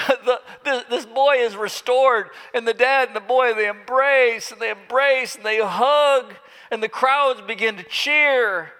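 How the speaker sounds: high, loud joyful telling with laughter; jubilant narration. A narrator's reading. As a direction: celebrate the healing aloud.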